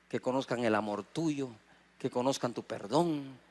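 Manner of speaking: soft, low prayer to the Lord; quiet prayer. Acted. Pastor showing how he prays for his critics, quietly. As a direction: pray under your breath, humbly.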